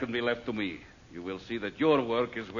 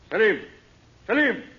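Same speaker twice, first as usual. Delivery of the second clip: loud, high call across the house; 12 semitones higher, 11 dB louder than usual. Acted. Master calling for his servant, shouting. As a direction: summon the servant from afar.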